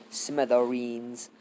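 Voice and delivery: SMITHEREENS stretched out slowly; drawn out. Real, not acted. Narrator repeats how the golem crushes them, SMITHEREENS drawn out slowly. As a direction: savor SMITHEREENS at half speed.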